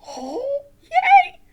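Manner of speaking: high, loud puppet cheer; big squeaky cheer; excited puppet. Pretend.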